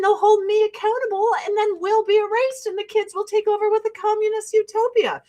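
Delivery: high, rushed hysterical parody; hammed-up alarmed parent; mock frantic parent. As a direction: work up into a high-pitched mock panic. Mocks parents keeping kids in school.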